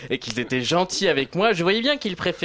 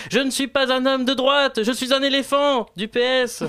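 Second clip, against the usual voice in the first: higher, louder protesting cry; shouting; 10 semitones higher, 3 dB louder than usual. A narrator's reading. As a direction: yell it at the crowd. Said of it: "J'avais beau leur crier".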